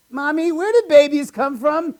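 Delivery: put-on high little kid voice; high child's question; child asking. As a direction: plead innocently like a small child. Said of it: "ask my parents a question".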